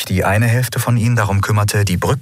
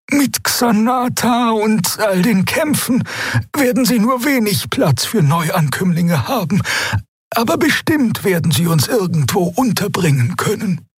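very high, slow, unsure voice; bird-like creature; 12 semitones higher than usual. A narrator's reading. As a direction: hesitate in a thin high voice.

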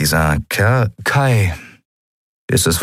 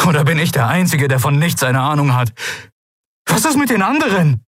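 high, agitated outburst climbing higher; barely controlled anger; 10 semitones higher than usual. A narrator's reading. Kai demands to know who else knew, barely controlled. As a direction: let indignation push the voice steadily upward. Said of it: "seine Stimme unter Kontrolle zu behalten".

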